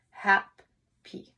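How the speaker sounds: "Happy" broken into its two syllables, hap and py; syllables split. Shown as a demonstration. Teacher showing the class where the syllables in "Happy" are. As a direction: say "Happy" with a clear break between the syllables. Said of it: "show you where the syllables are".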